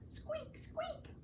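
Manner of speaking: tiny, very high, soft squeak; lantern squeak. A narrator's reading. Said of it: "went the lanterns".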